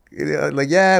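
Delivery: ordinary delivery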